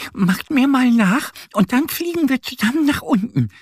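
hohe Entenstimme, laut und aufmunternd; ermunternde Ente. Acted. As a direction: mach den Küken Mut zum Mitmachen. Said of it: "schlägt Annie vor". (aufmunternde kleine Ente)

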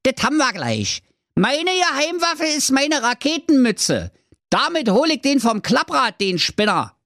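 high squeaky cheeky dwarf voice. A narrator's reading. Dwarf Mirko boasts about his rocket hat, cheeky and high. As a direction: chirp it out, cocky and sure. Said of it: "rief der Zwerg Mirko keck".

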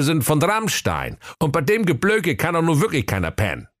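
high-pitched grumbling sleeper-car conductor; comic high fool griping; high sulky conductor. Acted. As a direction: whine about the job in a high silly voice. Hero Schwerthelm's grumbling monologue.

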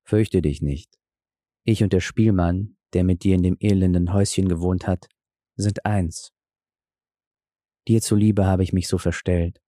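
calm her fear kindly; kindly reassurance. A narrator's reading. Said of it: "Er sprach ihr freundlich zu".

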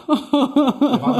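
laughing through the words, pitched high; giggling question. Real, not acted. Laughs while asking about Ballack.

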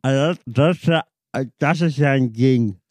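talking with mouth held open; slurred words, mouth wide open. Retold or imitated. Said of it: "während ich den Mund auf hab".

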